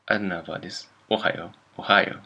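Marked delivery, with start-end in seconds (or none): 1.1-2.2 ohayo said like Ohio with English stress; English-accented misreading. Retold or imitated. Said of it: "possibly with accent".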